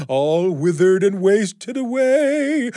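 slow, high, eerie ghost voice; drawn-out spooky moan. Acted. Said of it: "same spooky voice".

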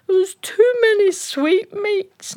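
put-on high, slow crying child's whimper; the queen sulks like a child in bed; whiny child. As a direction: blubber it like a sulking little girl. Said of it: "she sits there like a child going".

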